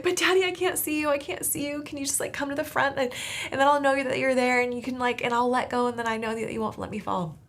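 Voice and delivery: put-on high, urgent little-girl voice; pleading little girl. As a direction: beg daddy to come to the front. Six-year-old self pleading with dad.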